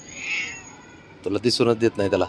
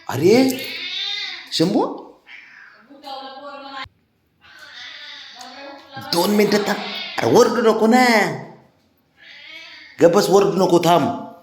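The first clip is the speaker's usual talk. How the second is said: high coaxing call; 13 semitones higher than usual. Real, not acted. Calling and coaxing the cat.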